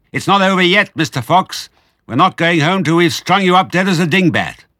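high, loud threatening yell; shouting down. A narrator's reading. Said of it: "shouting down the hole".

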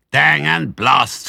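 loud, slow, high grumble; slow angry curse. A narrator's reading. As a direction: spit out the curse in frustration. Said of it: "said Boggis".

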